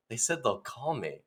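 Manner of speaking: soft, understated disappointment; dejected. Acted. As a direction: report the letdown quietly. Dejected actor reports failed audition.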